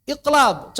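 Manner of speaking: "Iqlab" with bouncing q and b; loud, high "Iqlab", consonants released with an echo; with qalqalah. Shown as a demonstration. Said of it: "Coba tanpa qalqalah".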